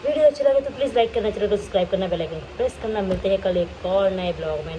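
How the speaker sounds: fast, bright like-and-subscribe plea; upbeat outro pitch. Acted. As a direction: rattle off the outro with a lift at the start. Channel outro call to action. (जोश भरा outro, तेज़ी से)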